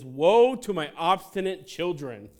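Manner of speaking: mock divine father, loud and slow; God comes home. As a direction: thunder it like an angry father. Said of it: "So God comes home and says".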